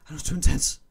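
ordinary delivery

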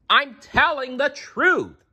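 high, drawn-out protest; indignant cry. A narrator's reading. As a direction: cry it out slowly, insisting. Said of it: "Sarah cried".

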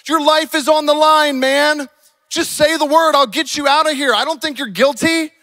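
high, loud pleading pressure; urgent demanding. Acted. Pilate urging Jesus to save his life, urgent demanding. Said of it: "Pilate demanded".

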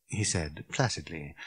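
plain reading voice

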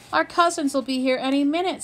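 fast, high, flustered; a girl fretting as guests near; anxious rush. A narrator's reading. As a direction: hurry the words out in a panic. Kirsten panicking.

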